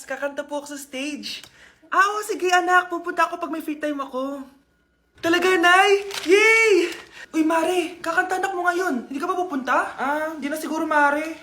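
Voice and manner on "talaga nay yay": excited. Acted. Child thrilled mother will come.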